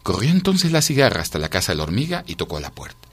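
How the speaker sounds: usual speaking voice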